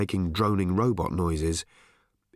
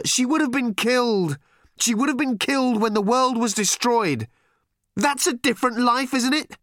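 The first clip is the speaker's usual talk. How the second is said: Arthur's voice raised in distress, higher and louder; lightly voiced pleading father; 14 semitones higher, 4 dB louder than usual. A narrator's reading. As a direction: argue desperately with the girl. Arthur pleading with his daughter.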